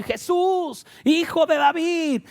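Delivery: high, slow shouted plea; drawn-out yell for mercy; crying out. Acted. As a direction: call out loudly, stretching the words. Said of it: "comenzó a dar voces y decir".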